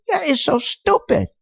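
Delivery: exasperated scorn; scornful. Real, not acted. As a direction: repeat it with pitch jumped way up in disbelief. Scorns the plan.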